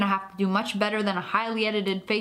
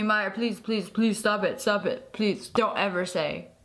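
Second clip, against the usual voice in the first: low possessed plea; 7 semitones lower than usual. Acted.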